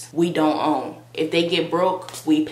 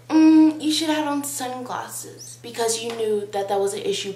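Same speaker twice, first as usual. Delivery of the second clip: know-it-all critic; 7 semitones higher than usual. Retold or imitated. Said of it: "some people are gonna be like".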